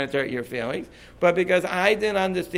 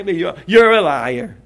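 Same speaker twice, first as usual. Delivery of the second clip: mock accusation; 9 dB louder, slower than usual. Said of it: "And this guy".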